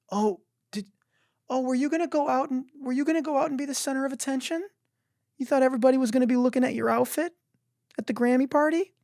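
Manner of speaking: very high, fast nasal-free sing-song mockery; high mock-innocent gloating voice; mocking taunt. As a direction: tease like a smug schoolkid. Taunting imagined rival partygoer.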